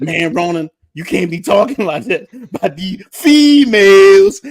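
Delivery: hammed-up lecture from a prudish viewer; mocking a scold. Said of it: "some simp dude emails me".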